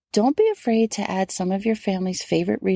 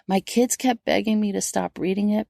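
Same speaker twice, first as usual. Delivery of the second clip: ordinary delivery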